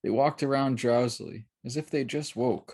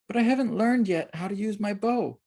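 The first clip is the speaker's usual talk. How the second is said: put-on child voice; 10 semitones higher than usual. A narrator's reading. Child character Eli speaks.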